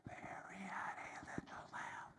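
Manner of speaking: slow, soft whisper spoken on the in-breath; inhaled whisper. Shown as a demonstration. Teacher demonstrating speaking while inhaling. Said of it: "saying the same thing while we inhale".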